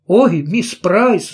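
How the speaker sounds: slow, high plaintive exclamation; plaintive cry. Acted. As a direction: cry out pleading and dismayed. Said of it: "жалобно воскликнула она".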